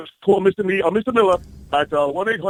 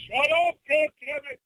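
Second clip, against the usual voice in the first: high yell off the phone; angry yell; 10 semitones higher than usual. Acted. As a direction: snap it over your shoulder. Caller yells at wife.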